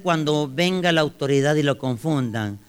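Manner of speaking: loud, slow preaching delivery; emphatic preaching. Real, not acted. Preacher advising the congregation on police confusion, emphatic preaching. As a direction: ring out the question slowly to the congregation.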